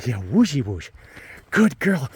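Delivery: ordinary delivery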